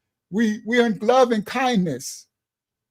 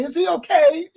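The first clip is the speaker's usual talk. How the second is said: worried daughter; 7 semitones higher than usual. Retold or imitated. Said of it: "Delilah Shah say".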